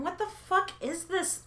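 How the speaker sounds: high stunned disbelief; incredulous. Acted. Reacting to a sexless royal marriage, aghast. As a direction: ask it baffled and aghast.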